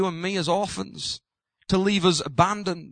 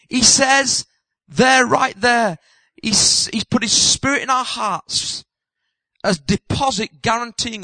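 slower, louder, leaning on each phrase; emphatic preaching; 4 semitones higher, 5 dB louder, slower than usual. Acted. Preacher pointing the congregation to the verse, slow and emphatic. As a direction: drive home "right there" deliberately.

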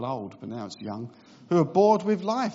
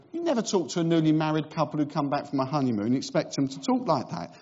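ordinary talk

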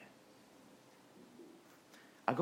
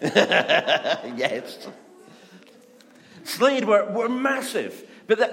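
ordinary talk, nothing marked

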